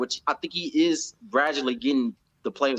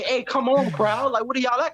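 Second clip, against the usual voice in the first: rallying call; 10 semitones higher than usual. Retold or imitated. Coach rallying the crowd.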